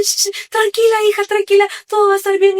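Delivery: calm her down like a loving aunt; soothing aunt. Retold or imitated. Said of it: "Y me dice".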